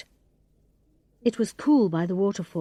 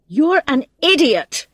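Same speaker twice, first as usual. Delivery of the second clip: louder, higher angry rebuke; angry outburst; 5 semitones higher, 6 dB louder than usual. A narrator's reading. Thomas yells at Sean for the prank, angry. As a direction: let him have it.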